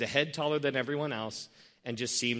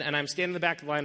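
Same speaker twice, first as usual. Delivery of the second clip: normal talking voice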